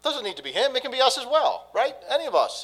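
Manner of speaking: loud, high, fervent preaching; preaching at full force. Acted. Pastor urging the congregation toward hope, preaching full force. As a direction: lift the whole room with the good news.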